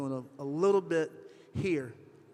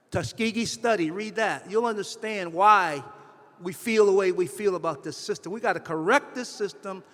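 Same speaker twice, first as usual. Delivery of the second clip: louder, rising passion, pressing every line; getting emotional; 3 semitones higher, 7 dB louder than usual. Real, not acted. Speaker urging the audience to fix the healthcare system, emotional and loud. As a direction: pour real feeling into the call to fix the system. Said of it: "I do apologize for getting emotional".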